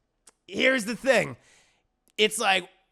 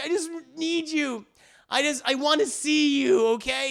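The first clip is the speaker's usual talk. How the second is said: high, loud pleading lover; 7 semitones higher, 4 dB louder than usual. Retold or imitated. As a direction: beg him in a high voice. Chuck pleading on the phone.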